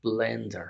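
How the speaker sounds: blender said slowly and clearly, stress on the first syllable; model word blender. Shown as a demonstration. Teacher modelling blender slowly for learners. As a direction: pronounce blender carefully for the class. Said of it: "Como por ejemplo esta palabra".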